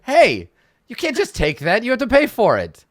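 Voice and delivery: high, loud indignant shopkeeper; indignant shout. Acted. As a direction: yell at a customer walking off with the goods. Gnome shopkeeper protests at theft.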